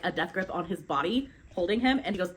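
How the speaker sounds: normal talking voice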